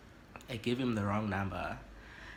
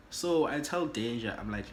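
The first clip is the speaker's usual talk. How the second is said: plain reading voice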